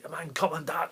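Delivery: a retold German accent, hammed up a little. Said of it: "put on this phenomenal German accent".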